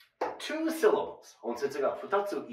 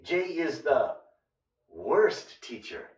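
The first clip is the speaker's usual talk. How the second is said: slow model sentence; slower than usual. Shown as a demonstration. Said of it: "slow model sentence".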